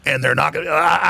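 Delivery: usual speaking voice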